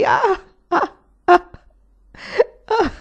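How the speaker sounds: high, gasping sobs without tears; choked sobs of remorse and horror; dry sobbing. A narrator's reading. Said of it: "Egli singhiozzò senza lacrime".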